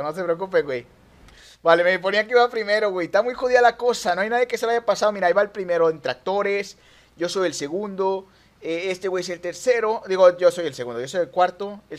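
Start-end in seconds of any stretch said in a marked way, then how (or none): none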